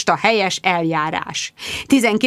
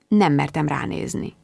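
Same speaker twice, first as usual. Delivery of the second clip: ordinary delivery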